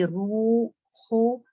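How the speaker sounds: long रूह with audible breathy ह; रूह with full u and ह; clear ha. Shown as a demonstration. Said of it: "हा भी निकले और दम्मा भी".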